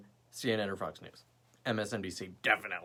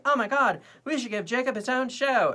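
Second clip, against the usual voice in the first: high, louder mock gushing; gushing fans; 11 semitones higher, 4 dB louder than usual. Imagined viewers demand Jacob's show, high mock gushing. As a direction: play viewers thrilled by their own idea.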